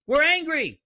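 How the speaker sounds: shouted high and loud, full of anger; angry shout. Real, not acted. Host to his viewers, shouting his anger. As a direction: let the anger burst out loud. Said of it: "I'm angry".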